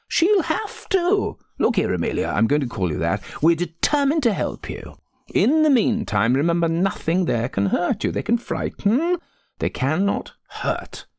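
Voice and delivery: high-pitched, emphatic insistence; forcible manner. A narrator's reading. Rector's wife determined to help the frightened maid, forcible manner. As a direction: drive each reassurance home forcefully. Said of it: "her most forcible manner".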